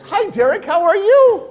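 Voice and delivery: very high, loud mock-cheery hello; hammed-up happy man; happy greeting. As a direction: greet him with over-the-top cheer. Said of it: "I felt his happiness".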